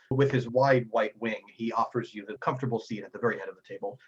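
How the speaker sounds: normal talking voice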